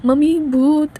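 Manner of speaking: frightened yelp of a ghost; scared cry. A narrator's reading. Drunk wife startled in the dark, scared cry. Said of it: "Samira बहुत ज़्यादा डर जाती है".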